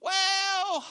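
high, drawn-out hesitant "Well"; stalling mechanic. Retold or imitated. Body shop man stalls the pastor with a high drawn-out "Well". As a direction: imitate the clerk bracing to give bad news. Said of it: "and then I get this".

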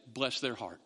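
low, soft southern bless their heart; mock southern gossip. Mimicking a southern gossip to the congregation, low and soft. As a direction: drawl it like a sweet-tongued southern gossip. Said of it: "The southern way to do that is".

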